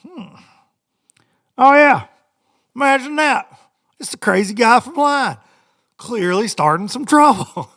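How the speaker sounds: mock knowing sarcasm; sarcastic inner voice. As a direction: roll your eyes at the trouble. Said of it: "I'm like".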